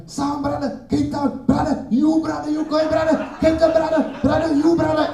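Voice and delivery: high, loud hustling taxi caller; taxi guard. Retold or imitated. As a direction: bark it like a taxi guard chasing riders. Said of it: "the guy is like".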